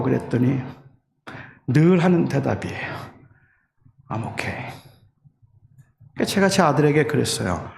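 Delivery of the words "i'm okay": barely voiced, nearly a whisper; whispered reply. Son in pain whispering to his father, hushed. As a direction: breathe it out as a hushed brush-off. Son's whispered stock answer.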